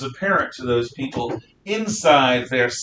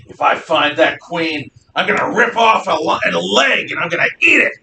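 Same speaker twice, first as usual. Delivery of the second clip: mob woman shouting; 5 semitones higher, 5 dB louder than usual. Retold or imitated. Rioting woman shouting threats as she storms the palace. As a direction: bellow it like a hungry rioter. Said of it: "they're just shouting them at the top of their lungs".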